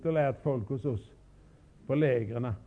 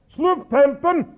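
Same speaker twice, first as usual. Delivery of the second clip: Slå upp tälten barked louder and higher; harder command; 8 semitones higher, 6 dB louder than usual. Retold or imitated. Colonel ordering his bearers a second time, barked harder. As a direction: bark the order like an officer. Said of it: "Jag tog igen det hårdare".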